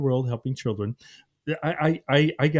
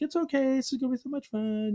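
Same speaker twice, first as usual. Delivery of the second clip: put-on much higher, soothing voice for a scared toddler; reassuring a child; 13 semitones higher, 3 dB quieter than usual. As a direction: coax her gently, like a nervous dad. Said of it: "and I'm like".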